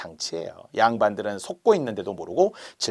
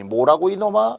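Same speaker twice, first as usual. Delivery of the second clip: mock indignant retort; 6 semitones higher than usual. Nobleman's angry retort to servant.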